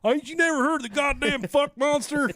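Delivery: ranting trailer-park homeowner; man down shout. Acted. Hillbilly trailer owner complaining.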